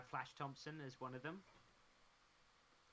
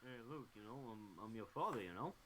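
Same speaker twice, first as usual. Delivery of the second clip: Stallone as Vader; 5 semitones lower than usual. Pretend. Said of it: "Stallone as Darth Vader".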